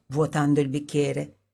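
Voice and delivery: plain reading voice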